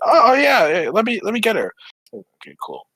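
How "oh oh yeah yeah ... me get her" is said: high, loud, flustered mock eagerness; imitating eager helpfulness. Coworker at the register offering to fetch Alicia, imitated eagerly. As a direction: play an over-eager helper, pitched up.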